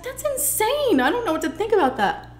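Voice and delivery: pitched far up, amazed; shocked disbelief. Retold or imitated. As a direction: sound floored by what you just heard. Reacting to mom's words.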